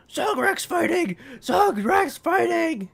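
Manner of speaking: high, loud goblin hype cry; goblin crowd shout. Acted. Goblins going around announcing the fight, high-pitched shouting. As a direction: shout it like an excited goblin herald.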